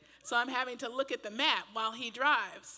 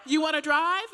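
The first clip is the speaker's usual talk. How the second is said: louder, higher snapped challenge; mock husband outburst; 6 semitones higher, 10 dB louder than usual. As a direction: fire it back like an annoyed husband. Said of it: "he's like".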